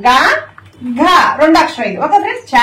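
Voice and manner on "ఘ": ఘ voiced with a heavy breath release; aspirated letter. Shown as a demonstration. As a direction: push breath through ఘ. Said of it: "ఇవి ఒత్తులు పలుకుతున్నాయి". (ఘ ఒత్తి పలకడం)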